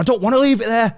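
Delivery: high, indignant mimicry; heated comeback, pitched well up; retold angry refusal. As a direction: refuse sharply in imitation of an aggressor. Aggressor refusing the apology.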